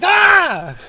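loud, high-pitched shriek; sudden comic scream of terror; mock horrified scream. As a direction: scream like a horrified new mother. Horrified mother's scream acted.

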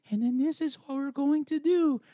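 mock a grown woman playing a teenager; mocking teen girl voice. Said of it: "she sounds like seventy-two-year-old Sigourney Weaver".

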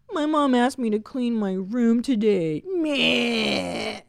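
mock whine ending in a fake cry; whining teenage girl. High schooler complaining in group therapy, mock whining. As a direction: imitate a girl griping over a petty chore. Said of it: "the other girls would be like".

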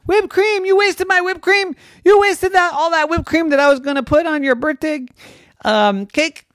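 high, loud, indignant shrieking; outraged mom. Acted. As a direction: scold in shocked outrage. Mom outraged over whipped cream.

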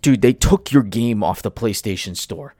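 usual speaking voice

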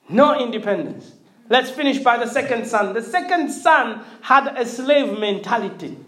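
ordinary talk

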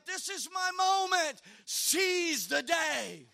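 mock-heroic desperate cry, drawing out the last words; rally yourself in comic desperation. Preacher reliving his moment in the booth, desperate battle cry. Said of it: "I'm just, I'm desperate".

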